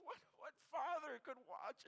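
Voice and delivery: imitating a professor overcome, high-pitched; high, mildly tearful quote; retold tearful outburst. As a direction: choke up in retelling the grief. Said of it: "he just started crying".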